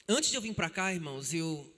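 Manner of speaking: normal talking voice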